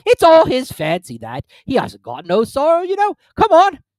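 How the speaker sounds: loud, high gruff creature; hammed-up Gryphon; rough Gryphon voice. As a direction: boom it out like a rowdy beast.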